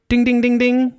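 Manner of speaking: high, ringing ding-ding-ding; rapid high dings; imitated bell. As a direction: go off like an alarm bell on one high note.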